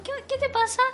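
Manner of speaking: high, puzzled "qué te pasa"; concerned question. Retold or imitated. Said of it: "Yo así como".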